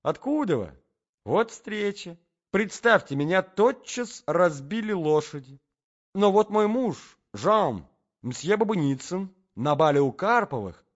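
high, loud social twittering; chirping. A narrator's reading. Lady introduces her husband to the tall gentleman, chirping. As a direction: chatter brightly up high. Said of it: "защебетала дама".

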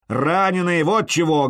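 loud bark, punching вот; barked shout. A narrator's reading. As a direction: bark it back up the wall. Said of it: "гаркнул я".

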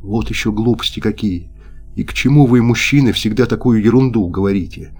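plain reading voice

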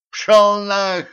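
high, loud brush-off; dismissive shout. Acted. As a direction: wave him away with a shout. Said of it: "отмахнулся Венька".

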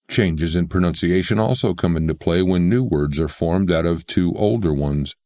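ordinary talk, nothing marked

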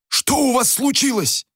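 acted exasperated questioning; stern demand. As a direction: demand an explanation after a brawl. Innkeeper demands account after brawl.

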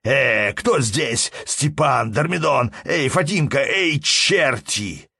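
loud, slow calling out; shouting at the top of his lungs. Acted. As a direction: bellow for the servants in panic. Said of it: "закричал, что было мочи".

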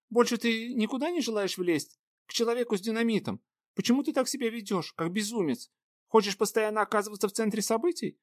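put-on high, scolding young woman; stern scolding. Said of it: "строго спрашивала она".

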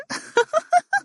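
bubbly, very high-pitched laugh; high giggle. A narrator's reading. Wife laughing off her husband's teasing, high giggle. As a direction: let out a playful run of giggles. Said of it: "ضحكت".

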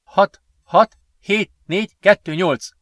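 high-pitched, distinct dictation of digits; dictating. A narrator's reading. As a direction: dictate the code out loud, digit by digit. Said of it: "diktálta Sven".

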